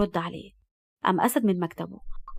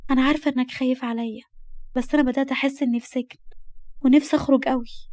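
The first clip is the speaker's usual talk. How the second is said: put-on higher girl's voice, gently complaining; wistful young girl; 4 semitones higher than usual. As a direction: plead to be let out, pitched up. (بصوت بنت بتشتكي وتترجى)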